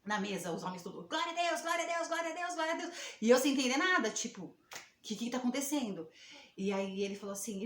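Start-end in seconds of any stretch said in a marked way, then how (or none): 1.1-3.0 higher, ecstatic praise chant; imitating men shouting praise; joyful cheering.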